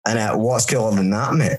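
ordinary delivery

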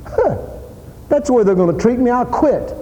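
high, fast, petulant sulk; mock a sulking quitter giving up; playing a hurt churchgoer who whines he'll quit; whiny quitter. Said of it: "we'd just quit".

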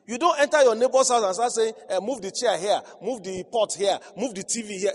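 loud mock bossy orders; loud preacher mock command; preaching. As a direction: mimic a meddling neighbour bossing around, loud.

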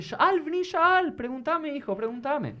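high, slow and gentle; patient master. Acted. Hillel gently inviting the pestering man to ask, high slow patience. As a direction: invite the question with endless patience. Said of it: "muy atento".

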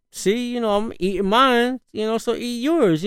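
pitched high, coaxing; coaxing dad. Retold or imitated. Dad coaxing his daughter to eat, pitched high. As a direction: coax a picky kid to take a bite.

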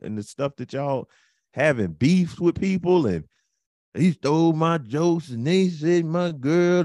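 rising preacher cadence; Baptist preacher. Real, not acted. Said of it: "You sound like a Baptist preacher".

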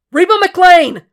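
full-throated yell over the roar of a fire; desperate shout. A narrator's reading. Will searching the burning house for Reba, desperate shout. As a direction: scream her name, high and loud. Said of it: "he shouted".

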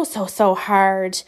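slow, each "so" stretched out; emphatic drawn out. Real, not acted. Venting about TikTok creators, "so, so hard" drawn out slowly.

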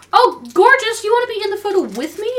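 high, loud, quick friendly voice actor; sweet greeting. Retold or imitated. Voice actor invites a fan into the photo, high and bright. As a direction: gush sweetly at a shy fan. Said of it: "he's all like".

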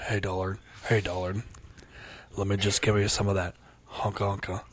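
hammed-up [name] come-on; [name] impression. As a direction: croon it like [name]. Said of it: "like [name]".

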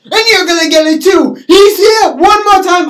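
high, loud acted rant; shouted threat. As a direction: scream it as the enraged boyfriend.

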